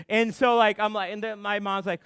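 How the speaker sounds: ordinary talk, nothing marked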